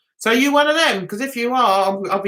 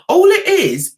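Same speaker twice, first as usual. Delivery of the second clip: usual speaking voice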